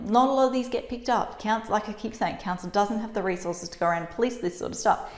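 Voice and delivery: ordinary talk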